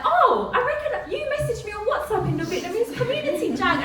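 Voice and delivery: high, laughing quoted voice; imitating Ha. Host imitating the translator Ha recognising her, laughing. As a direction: lightly voice a friend, amused. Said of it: "Ha's like".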